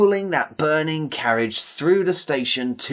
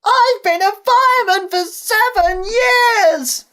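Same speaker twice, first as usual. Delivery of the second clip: very high, loud, drawn-out holler; slow, piercing alarm call; yelling up; 7 dB louder, slower than usual. A narrator's reading. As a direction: yell it urgently up to the driver. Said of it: "yelled up to Ben".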